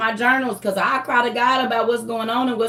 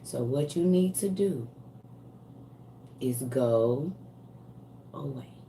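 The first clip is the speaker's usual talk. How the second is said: menacing quiet; 8 semitones lower, 10 dB quieter than usual. Real, not acted. Warning the stalker.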